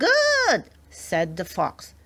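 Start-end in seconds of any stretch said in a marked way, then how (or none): 0.1-0.6 very high, loud one-word reply; high-pitched fox reply. A narrator's reading. Fox accepts the peasant's bargain.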